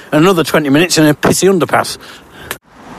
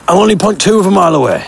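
ordinary delivery

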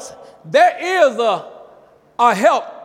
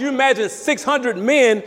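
ordinary talk, nothing marked

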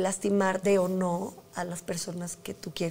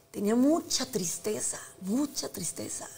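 softer and slow, fading into a whisper; subdued sadness; 7 dB quieter, slower than usual. Real, not acted. Telling the host about her pain, subdued real sadness. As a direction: let the grief pull the voice down. Recalling deep grief.

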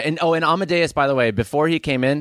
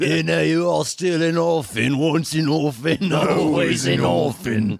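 slow sneering taunt; slower than usual. Acted. Beefy taunts orphan.

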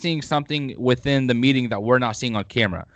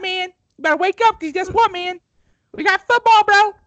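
way higher, louder hype-man voice; hyped-up weird voice; 4 dB louder than usual. Pretend. Host waking up his co-host, over-the-top hype voice. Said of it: "I'm done with that accent".

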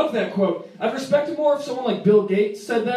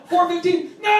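mock panicked shout; 8 semitones higher than usual. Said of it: "My buddy's like".

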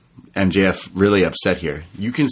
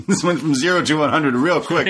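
ordinary talk

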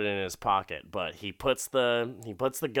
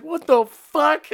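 startled yell; 9 dB louder than usual. Retold or imitated. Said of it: "He's like".

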